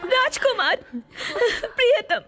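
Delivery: sobbing plea. Acted. Princess begging her dying beloved to stay, sobbing. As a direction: beg him through tears not to leave.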